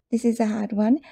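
normal talking voice

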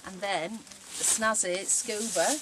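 put-on slow, loud Yorkshire accent; drawn-out broad Yorkshire. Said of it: "Tried to put on my best Yorkshire accent".

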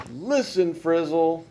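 yelling. A narrator's reading. Gus answers back at Frizzle, yelling. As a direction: yell it to cut him off. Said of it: "yelled Gus".

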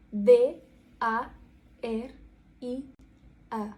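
"D A R I A" spelled letter by letter; по літері. Shown as a demonstration. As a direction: separate letter names, pause after each. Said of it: "сказати по літері ваше ім'я". (ім'я по літерах, з паузами)

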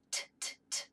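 short unvoiced T clicks; crisp T taps. Shown as a demonstration. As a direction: tap out "T" like a tooth. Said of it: "tap his tooth like this".

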